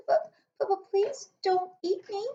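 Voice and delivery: stammering. Acted. Sophie begging the giant not to eat her, stammering. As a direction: stammer it out, high and scared. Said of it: "Sophie stammered".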